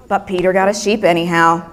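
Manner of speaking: ordinary delivery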